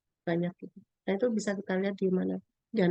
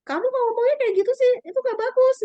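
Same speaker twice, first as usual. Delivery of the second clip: much higher and louder self-scolding; scolding the mirror; 7 dB louder than usual. Retold or imitated. Woman scolding her reflection in the mirror, high and loud. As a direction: tell yourself off sharply.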